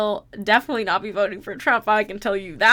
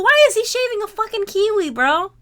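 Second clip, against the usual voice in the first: higher, louder disbelieving shout; loud disgusted outburst; outraged yell; 9 semitones higher, 6 dB louder than usual. Real, not acted. Outraged at shaving ad.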